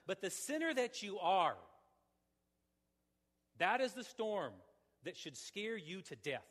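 loud, rising hellfire sermon voice; preacher driving the congregation toward God's wrath, loud preaching; full-force preaching. Acted. Preacher warning the room of judgment, loud and pressing.

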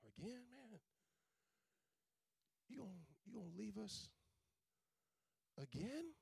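hushed, pleading disbelief; a hushed, hurt Peter pleading; Peter's dismay. Retold or imitated. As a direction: softly ask him not to leave again. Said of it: "I can imagine Peter like".

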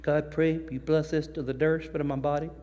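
put-on soft, earnest little-kid grace; a child proudly trying out a fancy word; lightly voiced kid praying; child copying prayer. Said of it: "you did it in front of your mama".

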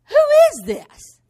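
a bewildered cry of who is this; high, loud puzzled question. Retold or imitated. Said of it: "David is just puzzled".

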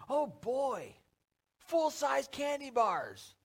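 high-pitched, drawn-out mock delight; thrilled kid. Trick-or-treater getting big candy, thrilled. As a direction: marvel slowly, stretching out the candy.

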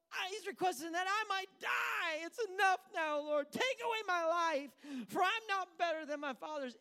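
high, loud melodramatic wail; pouting prophet. Acted. Preacher acting the prophet under the tree, loud pouting wail. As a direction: cry it out, hammed-up despair. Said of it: "Dude starts pouting".

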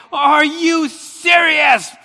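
angry, frustrated shout at the TV; outraged fan's high-pitched yell; screaming and yelling. Retold or imitated. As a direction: yell it high, loud and drawn out. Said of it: "screaming and yelling at the T".

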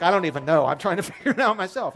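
sheepish laughing dad. Retold or imitated. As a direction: chuckle through the admission. Dad's answer with laugh.

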